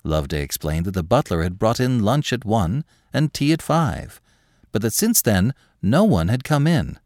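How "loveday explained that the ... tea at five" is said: usual speaking voice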